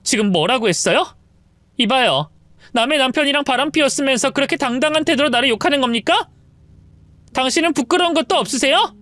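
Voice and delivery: wife confronting, rising indignation; indignant wife. Acted. Wife confronts the mistress, indignant. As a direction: push back at her, building up.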